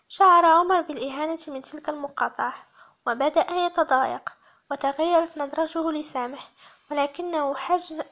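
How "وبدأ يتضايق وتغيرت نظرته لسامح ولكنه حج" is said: usual speaking voice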